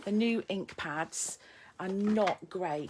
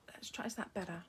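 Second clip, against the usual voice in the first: whisper; 11 dB quieter, faster than usual. Real, not acted. Adjusting camera, checking shot quietly.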